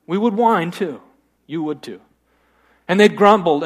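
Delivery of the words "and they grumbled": ordinary delivery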